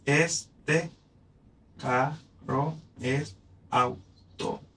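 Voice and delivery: slowed down, syllables separated; slow counting read. Shown as a demonstration. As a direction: lay each syllable out. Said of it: "Vamos a ver si es verdad".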